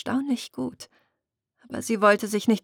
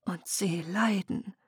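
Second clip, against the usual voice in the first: slow whispered realisation; 7 dB quieter than usual. Acted. Muriel realises her people suffer, whispered. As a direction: breathe it out, stricken.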